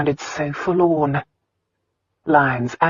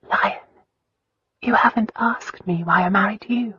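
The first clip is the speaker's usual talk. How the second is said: whispering; 7 semitones higher than usual. A narrator's reading. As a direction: whisper it close. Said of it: "she whispered".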